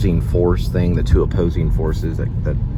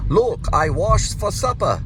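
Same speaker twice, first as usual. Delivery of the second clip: put-on a light German accent, slow and higher; 13 semitones higher, slower than usual. Quoting the German soldier in Saving Private Ryan, German accent. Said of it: "in, like, a German accent".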